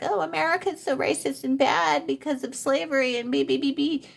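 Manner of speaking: high, sneering mock of preachy Americans. Mimicking smug Americans, high mocking voice. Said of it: "we're like".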